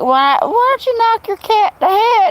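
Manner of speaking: put-on high sneering old-timer voice; mocking old man. Old men scoffing at her cat care, high mock voice. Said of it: "they're like".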